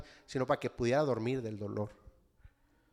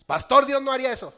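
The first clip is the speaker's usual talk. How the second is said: much higher, loud protest; objecting listener; 10 semitones higher, 14 dB louder than usual. Retold or imitated. Congregant objects to the pastor, high and loud. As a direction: burst out in disbelief.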